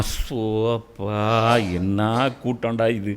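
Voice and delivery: imitated weariness. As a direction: act out being tired of the crowd. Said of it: "எவ்வளோ இடத்துல டயர்டாக".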